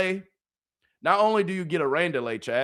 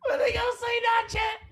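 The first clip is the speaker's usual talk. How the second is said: excited cry out. Real, not acted. Crying out in excitement.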